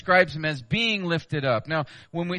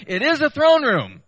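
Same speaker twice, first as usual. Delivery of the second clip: emphatic rise; 9 semitones higher, 7 dB louder than usual. Real, not acted. Preacher proclaiming to congregation, high and loud. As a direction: raise the voice high and loud on throne room. Preacher stressing the throne room.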